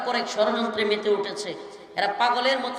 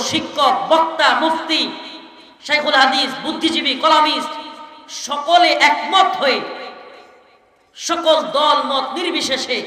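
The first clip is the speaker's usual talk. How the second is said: preaching at full force; 3 semitones higher, 7 dB louder than usual. Acted. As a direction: thunder the list at the gathering. Climactic sermon to the crowd. (গলা চড়িয়ে জোশের ওয়াজ)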